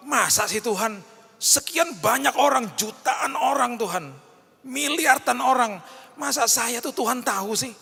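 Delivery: high, slow incredulous whine; doubting believer. Retold or imitated. Pastor voicing a doubting believer, high and incredulous. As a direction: sound like you can't believe God notices you.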